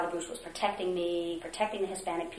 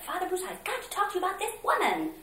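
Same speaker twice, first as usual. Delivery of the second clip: much higher, mocking tattling voice; whiny complaint; 13 semitones higher than usual. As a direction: mimic the director tattling to the priest. Retold director complaining to priest.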